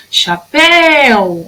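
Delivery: "Chapéu" as a drawn-out call, long final syllable; calling the word. Shown as a demonstration. Teacher calling the word to its house, long "péu" in "Chapéu". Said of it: "vou chamar a palavrinha lá na frente".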